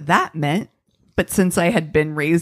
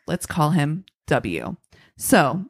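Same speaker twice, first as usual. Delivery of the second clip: ordinary talk, nothing marked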